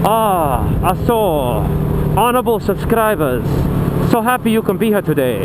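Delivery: lightly put-on Japanese accent, bowing politeness; a hint of a Japanese accent, hammed-up and polite; mock Japanese accent. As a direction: greet the subscribers in a playful fake Japanese accent. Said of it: "I don't do a good Japanese".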